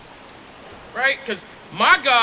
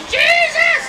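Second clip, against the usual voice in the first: a sudden high, loud cry of the name; exclaimed shout; 5 dB louder than usual. Real, not acted. Preacher mocking the picture with a loud, high exclamation.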